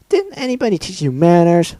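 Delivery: high, sharp scolding as Pinkie. Acted. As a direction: scold her like a spoiled host. Pinkie scolding her victim.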